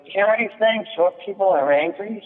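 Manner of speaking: high, prying voice; waitress poking at diners at the next table, high and prying; nosy waitress. Retold or imitated. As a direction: lean in and stir it up in a high voice. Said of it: "she goes".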